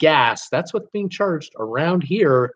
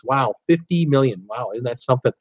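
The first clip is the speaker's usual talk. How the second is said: ordinary talk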